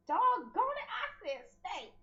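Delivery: God scolding the Hebrews like a pet; exasperated God. Retold or imitated. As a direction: scold like a frustrated dog owner.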